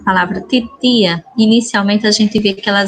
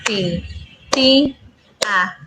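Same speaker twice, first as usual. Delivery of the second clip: "Ti, ti, a" in separate pieces with pauses; syllable by syllable; 5 dB quieter, much slower than usual. Shown as a demonstration. Teacher splitting a word for pupils, syllable by syllable. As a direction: say each syllable of "Ti, ti, a" alone. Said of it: "vamos novamente".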